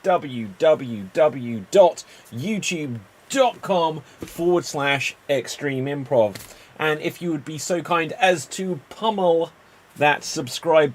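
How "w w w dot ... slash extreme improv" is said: slow spelled-out address. Acted.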